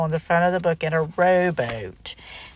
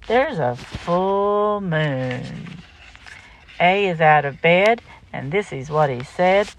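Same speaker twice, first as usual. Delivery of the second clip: put-on lively singsong read-aloud for kids; reading to children; 3 semitones higher, 5 dB louder than usual. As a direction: bounce through the rhyme for little listeners. Reads picture book aloud.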